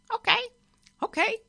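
very high little squawk; parrot voice. A narrator's reading. Parrot looking round the bus with wicked eye, squawking. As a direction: squawk it in short high bursts. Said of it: "it said".

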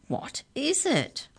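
high, slow, drawn-out question; repeated high question. A narrator's reading. Giantess repeats her question on spying Mallory, high and slow. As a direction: stretch it out high, without shouting. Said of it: "she repeated".

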